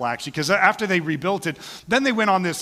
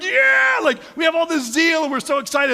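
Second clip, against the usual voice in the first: much higher and louder, hammed-up excitement; mock excited; 12 semitones higher, 5 dB louder than usual. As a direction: gush like a brand-new fan. Mock excited beginner's zeal.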